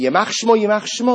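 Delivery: loud retold yell. Bystander hears the rabbi yelling loudly. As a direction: shout the curse out. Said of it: "he's yelling at me".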